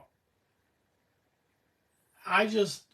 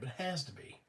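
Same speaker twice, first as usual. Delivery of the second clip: much softer repeat of it has to be, trailing down; quiet echo; 10 dB quieter than usual. Real, not acted. Repeating his own diagnosis to viewers, softly to himself. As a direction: say it has to be to yourself, under the breath.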